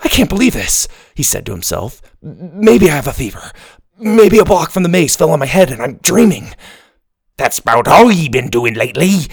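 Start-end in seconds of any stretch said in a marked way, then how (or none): 0.0-0.9 stunned disbelief. Acted. Ben stunned by talking book. 2.2-6.5 put-on panicky boy. Ben panicking over talking book. 7.4-9.3 pirate voice. Acted. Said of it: "the book went on".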